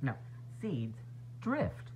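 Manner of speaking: ordinary talk, nothing marked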